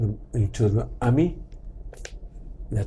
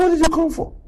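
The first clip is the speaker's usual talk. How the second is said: put-on high, puzzled question from an old woman; a lightly voiced elderly auntie; suspicious auntie; 14 semitones higher, 4 dB louder, faster than usual. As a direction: voice her wary surprise. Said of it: "He said".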